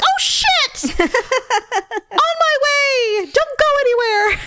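high laughing shriek of alarm; panicked surprise. Retold or imitated. Forgetful mom yelps on reading her daughter's text. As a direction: yelp it, caught out and laughing. Mom realizes she forgot pickup.

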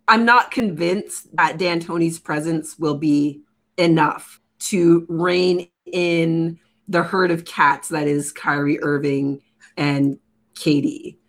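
ordinary delivery

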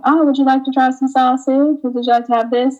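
high, lilting sales offer; grocery sample lady. Retold or imitated. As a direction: offer samples in a high, polite sing-song. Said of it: "you in the grocery store".

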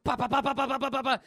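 rapid high, loud rat-a-tat; imitated gunfire. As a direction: mouth a burst of gunshots. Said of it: "they're getting shot at".

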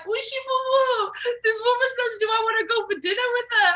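shrieked mock outrage, very high; retold shouting. Mimicking cousin outraged by a dinner invitation, high shrieking shout. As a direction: imitate a cousin squealing in outrage. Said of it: "she starts shouting at me".

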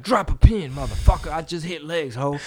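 loud, high, half-sung trash talk; tough-guy shout. Retold or imitated. Mock threat to a commenter, shouted half-sung. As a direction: yell it like a gym bro ready to fight. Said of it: "I'll be like".

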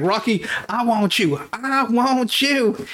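high, slow Uncle Sam call at Rocky. Retold or imitated. Apollo as Uncle Sam singling out Rocky, high and drawn out. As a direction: point and drawl it like the poster. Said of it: "He's like".

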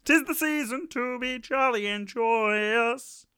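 high, slow frog puppet voice; sing-song Muppet frog voice, drawn out; Kermit the Frog. Acted. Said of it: "That was my Kermit the Frog impression".

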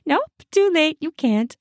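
ordinary delivery, nothing marked

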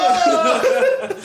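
high, laughing mock gasp of awe; a high astonished whoop through laughter; crowd's whoa. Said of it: "Everyone goes".

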